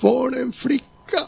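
high, tearful, slow cry of disbelief. Retold or imitated. Young woman at the restaurant crying to the preacher, high tearful cry. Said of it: "Hon bara skrek ut".

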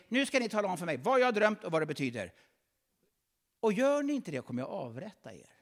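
high, loud, fast royal threat; threatening king. Acted. King threatens his wise men, a hint of a loud tyrant. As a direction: bark the order as the tyrant.

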